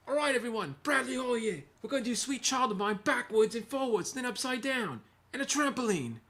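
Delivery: high, hammed-up British accent imitating a buddy; playful fake British accent introducing a video. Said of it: "sorry for the fake British accent".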